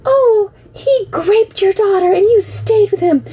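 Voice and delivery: high, mocking whiny voice of accusers; mock the people accusing her. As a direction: sneer it back in a shrill mock. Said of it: "So when people say".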